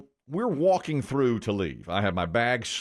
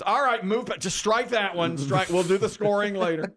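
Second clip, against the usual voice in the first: higher-pitched imitation of an irked quiz host; quizmaster moving on; 8 semitones higher than usual. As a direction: wave it away like a quizmaster on the mic. Quizmaster moving on, retold on mic.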